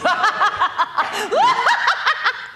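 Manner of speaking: high, loud evil cackle; evil villain laugh. Shown as a demonstration. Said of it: "the classic evil villain laugh".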